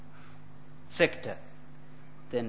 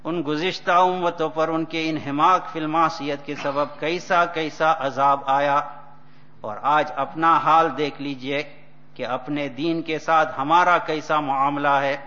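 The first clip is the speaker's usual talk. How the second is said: rapid, loud recitation of Urdu text; fast Urdu reading; 2 semitones higher, 5 dB louder, much faster than usual. Acted. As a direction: read the Urdu passage quickly and forcefully. Reads Urdu text in sermon.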